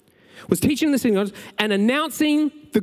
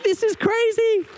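high, slow astonished "this is crazy"; amazed disbelief; 11 semitones higher than usual. Retold or imitated. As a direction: pitch it way up in wonder at the news. Amazed reaction to healing story.

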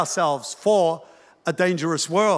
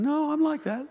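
small high voice, softer; mum's inner protest; 8 semitones higher, 6 dB quieter than usual. Acted. Mum listening and thinking she is tougher, high soft mock protest. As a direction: lightly imitate a mum piping up to herself. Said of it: "some of you mums are sitting here thinking".

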